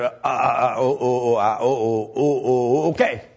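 halting stutter on oh; stuttering imitation. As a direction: stammer before the okay. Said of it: "I suppose that's how he said it".

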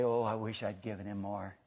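soft, low acted regret; wistful regret. Preacher voices saved Christians in heaven, hushed low regret. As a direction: sink into a hushed, low wish. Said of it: "You know what we're gonna say".

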